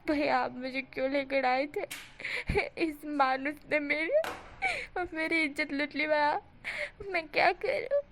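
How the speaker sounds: hammed-up crying complaint; crocodile tears. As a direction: wail to the kids about lost honour. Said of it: "चिल्ला चिल्ला कर रो रो के बोल रहा था".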